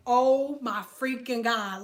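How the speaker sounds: usual speaking voice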